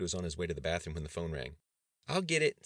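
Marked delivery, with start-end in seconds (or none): 2.1-2.7 put-on high, loud, eager child voice; proud little girl. A narrator's reading. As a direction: pipe up proudly like a small child. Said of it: "said Charlotte proudly".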